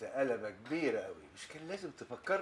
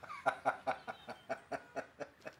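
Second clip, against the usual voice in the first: amused laugh; 4 dB quieter than usual. Acted. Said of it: "بضحك على اللي بيقوله".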